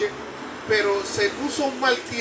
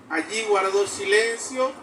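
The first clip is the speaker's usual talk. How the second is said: normal talking voice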